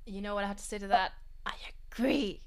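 ordinary talk, nothing marked

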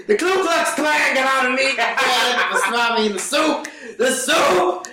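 high-pitched hammed-up bawling; Mister Ross crying. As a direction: wail it out like a mock sobbing victim. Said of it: "He's just crying his ass off".

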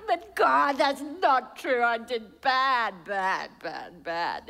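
slow, sinking into the repeated bad; a believer insisting on their own failure; mock guilty protest. Sinner protesting, acted.

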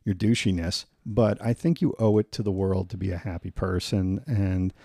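usual speaking voice

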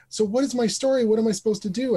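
high, eager child's questions, lightly voiced; curious little girl. Girl asking the players about her character, eager high child voice. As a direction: ask like a keen kid.

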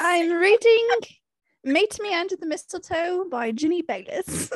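high-pitched put-on British accent. Shown as a demonstration. Podcaster giving her current read, high British accent. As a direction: announce it in a lofty British voice. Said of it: "I say it in a British accent".

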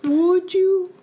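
squeaky high mock echo of would I. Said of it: "would I?".

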